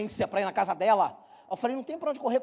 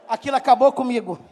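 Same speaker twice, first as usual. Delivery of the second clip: louder, laughing through the punchline; 2 semitones higher, 9 dB louder than usual. Real, not acted. Pastor laughing with the congregation at his own story. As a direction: crack up while telling it.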